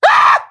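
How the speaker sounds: very high sudden scream; short high yelp; startled shriek. Acted. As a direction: shriek at the name. Reacts to named clown guest.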